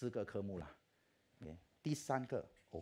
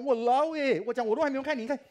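much higher and louder alarmed cry; panicked protest; 14 semitones higher, 13 dB louder than usual. Retold or imitated. Student protests as lecturer opens his results, loud alarmed cry.

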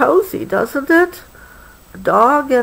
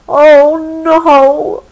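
raised, louder acted wail; dismayed cry; 7 semitones higher, 5 dB louder than usual. A narrator's reading. Billy sees the dog join the game, cries out in mock dismay. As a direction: wail it long, as storybook dismay. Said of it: "Billy cried".

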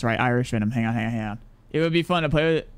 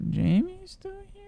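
very high, slow, softer put-on voice; hammed-up high-pitched disbelief; mock squeaky voice; 5 dB quieter, slower than usual. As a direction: ask it in a silly squeaky character voice. Repeating the question in mock voice.

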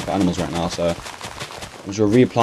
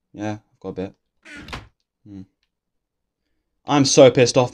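normal talking voice

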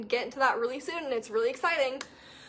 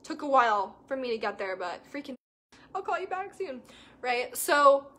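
usual speaking voice

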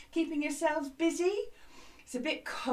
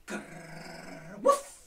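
playful dog noises; dog growl and woof; 4 semitones lower than usual. Shown as a demonstration. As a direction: growl like a dog, then bark. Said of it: "like a dog in the wash".

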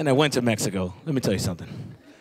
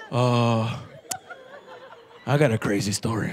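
lower, drawn-out mumble; low mumbling kid; 8 semitones lower, 4 dB quieter than usual. Retold or imitated. Boy telling teacher he has a story, low. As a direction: answer like a shady little kid.

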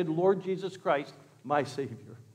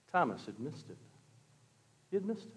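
very soft, confiding refrain; hushed aside; 3 semitones lower, 10 dB quieter, faster than usual. Real, not acted. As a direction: drop to a near whisper for effect.

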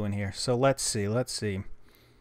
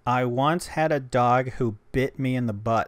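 louder, careful sentence for the translator; clear dictation into phone; 6 dB louder than usual. Shown as a demonstration. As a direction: read it out clearly for the app. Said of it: "let's try something".

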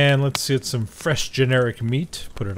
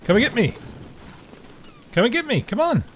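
high, taunting call; 10 semitones higher than usual. Real, not acted. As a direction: goad him into coming. Taunting the creature.

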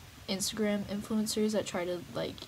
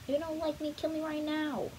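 high mock plea; 7 semitones higher than usual.

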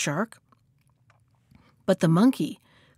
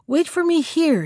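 higher-pitched light call; 6 semitones higher than usual. A narrator's reading. Monkey in the branches to the shark, calling out. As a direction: call down to someone below. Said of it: "he called out to the shark".

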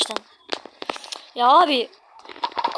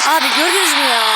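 loud startled question; startled shout; 8 dB louder than usual. Real, not acted. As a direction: shout it out in shock. Startled by the demon.

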